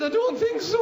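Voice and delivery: very high, loud wail; hammed-up wailing girlfriend; distraught woman. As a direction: wail the answer miserably.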